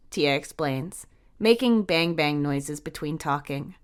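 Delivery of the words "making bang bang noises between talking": usual speaking voice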